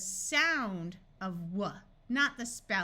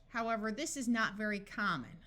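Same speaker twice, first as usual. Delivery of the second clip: ordinary delivery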